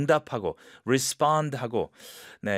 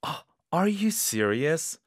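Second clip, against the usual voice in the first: good surprise; 5 semitones higher, much slower than usual. Shown as a demonstration. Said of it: "Good surprise".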